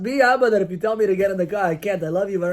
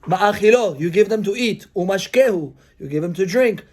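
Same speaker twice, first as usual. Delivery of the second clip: ordinary delivery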